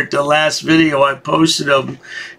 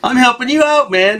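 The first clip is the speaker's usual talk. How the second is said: ordinary delivery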